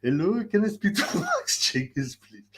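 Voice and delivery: lightly put-on polite caller voice, slow; pretend caller. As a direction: pose as a polite stranger on the phone. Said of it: "Pretending to be George Patel".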